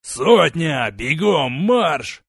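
loud military order; barked command. Acted. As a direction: bark the order to the troops. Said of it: "скомандовал Ридарон".

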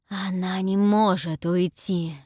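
slow, hissing snake-woman. A narrator's reading. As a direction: hiss it out slowly. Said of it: "шипит Гаргона".

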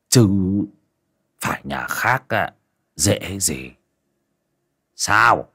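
a cold landlord speaking down from the porch; low and deep. Acted. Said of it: "giọng trầm trầm".

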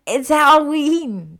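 high, loud, drawn-out squeal; tipsy, elated shriek; excited squeal. Acted. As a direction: squeal it like a thrilled party girl. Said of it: "Ginny squealed".

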